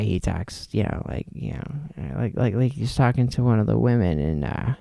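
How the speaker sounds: low, soft, halting mumble; Adam Sandler. Acted. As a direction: imitate a nervous guy being serious. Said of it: "talks like this".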